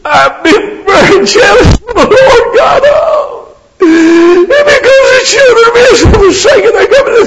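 very high, loud crying wail; whining Elijah. Acted. Elijah complaining to God, exaggerated bawling whine. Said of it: "give you the Elijah version".

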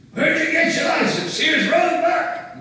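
high furious shout at traffic; angry driver yelling. Retold or imitated. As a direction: yell at the other driver. Road rage at driver who cut off.

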